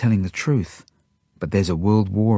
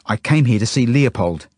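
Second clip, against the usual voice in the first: raised, higher-pitched angry protest; 4 semitones higher, 5 dB louder than usual. A narrator's reading. Dove turns on Genya before she can speak, angry. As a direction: cut in with indignation. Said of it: "he turns to her angrily".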